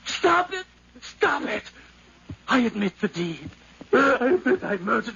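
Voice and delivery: high, shrieking plea; frantic scream. Acted. Nephew shrieking at the heartbeat. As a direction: scream it in torment. Killer breaking down.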